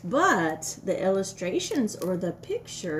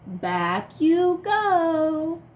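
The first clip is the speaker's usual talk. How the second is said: slow, playful sending-back; singsong telling-off; 6 semitones higher, 7 dB louder, much slower than usual. Acted. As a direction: draw it out like a game. Sending dinosaur back to tub.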